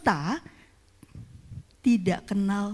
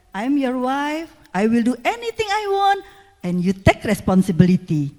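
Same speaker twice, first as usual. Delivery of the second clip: sassy, rising high and louder; defiant wife; 4 semitones higher, 5 dB louder than usual. Retold or imitated. Wife talking back to husband, defiant and sassy. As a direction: toss it back, daring him.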